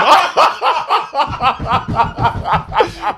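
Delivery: high, bursting laughter. Real, not acted. Laughing at name debate.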